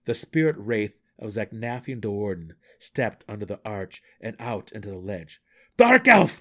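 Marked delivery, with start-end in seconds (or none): 5.8-6.4 a sudden sharp cry, much higher and louder; alarmed gnome calling out. A narrator's reading. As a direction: snap his name out in alarm. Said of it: "the Burrow Warden called sharply".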